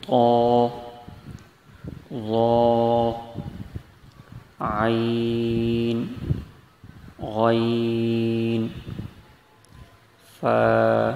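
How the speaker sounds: each Arabic letter drawn out and sung slowly; letter recitation. Shown as a demonstration. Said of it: "آگے پہلے پڑھ لیتے ہیں".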